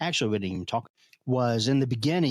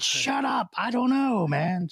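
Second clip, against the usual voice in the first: mock angry outburst; 12 semitones higher than usual. Voicing his character losing temper.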